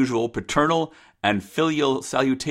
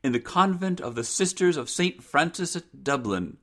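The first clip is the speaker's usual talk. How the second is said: higher, measured daughter's voice; earnest daughter; 6 semitones higher than usual. Acted. Rose tells mother of the convent, high and earnest. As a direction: declare her wish earnestly.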